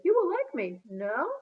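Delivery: put-on bright French visitor, a light accent. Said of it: "To Lucas she said".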